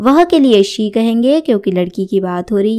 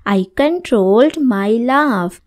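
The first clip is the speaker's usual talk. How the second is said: very slow, every word laid out clearly; slow model sentence; much slower than usual. Shown as a demonstration. Teacher reading the example sentence to students, very slow. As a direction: say it slowly so learners can follow. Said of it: "teacher models English sentence".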